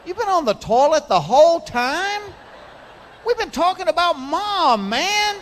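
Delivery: disgusted brother. Retold or imitated. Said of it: "you hear".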